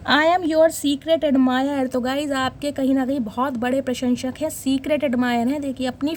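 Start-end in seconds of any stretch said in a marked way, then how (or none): 0.1-1.9 slow reading. Shown as a demonstration. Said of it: "reads card message slowly".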